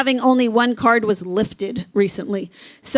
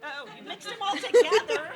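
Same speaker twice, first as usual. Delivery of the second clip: laughing; 9 semitones higher, 8 dB quieter than usual. Real, not acted. Caller mixed up the tickets.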